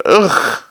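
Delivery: short guttural "ugh"; acted choke after a foul drink; disgusted grunt. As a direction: react to strong drink with a throaty ugh. Drinker reacts to a strong brew.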